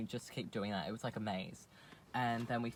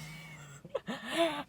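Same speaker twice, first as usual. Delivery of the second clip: laughing. Real, not acted. Laughing at her own retold yell.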